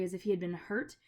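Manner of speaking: ordinary delivery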